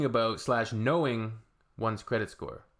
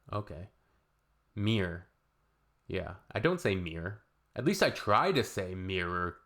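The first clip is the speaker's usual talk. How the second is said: ordinary delivery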